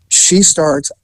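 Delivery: normal talking voice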